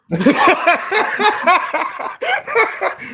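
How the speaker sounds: high, loud burst of delighted laughter. Real, not acted. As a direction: crack up at the line. Host reacts to the ad line.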